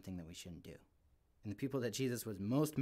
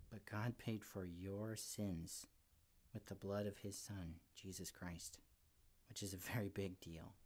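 solemn and tender; 3 semitones lower, 8 dB quieter than usual. Real, not acted. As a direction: lower your voice for the heart of the message. Solemn core of the sermon.

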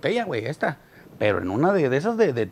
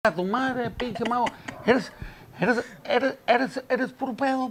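higher slurred stammering insults; drunk heckler; 10 semitones higher, 6 dB quieter than usual. Retold or imitated. Drunk man heckles the magician, higher and stammering. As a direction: stumble over the words like a drunk.

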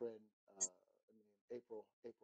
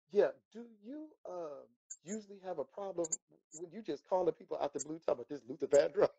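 cheeky disbelief; 7 semitones higher, 8 dB louder than usual. Retold or imitated. Teasingly questioning the caller.

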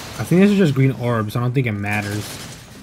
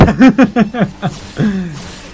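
long, higher-pitched giggling laugh; amused laugh; 5 semitones higher than usual. Real, not acted. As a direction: crack up at your own joke. Amused at own insult.

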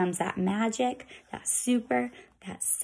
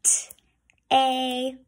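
whispered spelling; 3 semitones higher than usual. Shown as a demonstration. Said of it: "I'm gonna do that with you".

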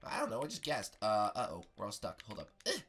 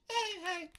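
Princess Peach; 3 dB louder than usual. Pretend. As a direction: greet in a small high squeak. Bart and Peach greet each other.

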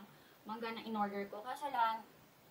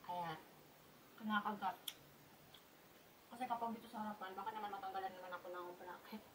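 talking while chewing; biting food while talking; 4 semitones lower, 13 dB quieter, faster than usual. Real, not acted. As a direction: mouth partly full. Seen 0: Bites mango strip with side teeth, then chews while talking.

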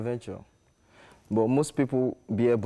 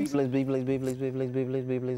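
fast repeated name; 3 semitones higher, faster than usual. Shown as a demonstration. Artist repeating his name on the host's dare. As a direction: rattle it off like a tongue twister. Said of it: "Do a tongue twister with your name".

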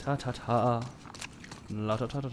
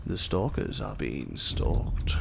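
slow low dramatic line; dramatic narrator; 7 semitones lower, slower than usual. Pretend. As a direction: deliver it like a trailer.

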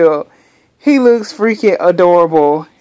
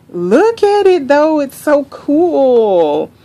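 high excited gush; 7 semitones higher, slower than usual. Real, not acted. Showing off car seat.

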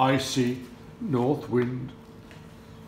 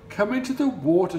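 higher, louder, fast swan voice; inviting swans; 7 semitones higher, 4 dB louder, faster than usual. Acted. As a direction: coax him in gently.

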